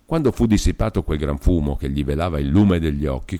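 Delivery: usual speaking voice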